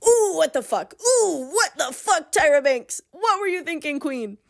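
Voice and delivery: high-pitched comic disbelief; mock outrage. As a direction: react in hammed-up horror. Mock horror at the book's line.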